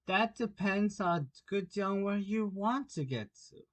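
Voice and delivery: lightly voiced sly grinning cat, amused; a hint of a grinning cat, unhurried; Cheshire cat voice. Cat's voice in the story.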